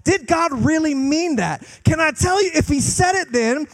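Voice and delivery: high, loud, full-force sermon; the preacher hammers it home to the congregation, at full force; fiery preaching. Acted. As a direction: preach it out with rising fire.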